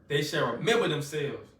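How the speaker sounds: ordinary talk, nothing marked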